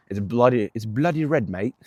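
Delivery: put-on playful British accent, pitch lifted on red; hammed-up British "bloody red, mate"; a quick mock British accent. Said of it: "The U K accent".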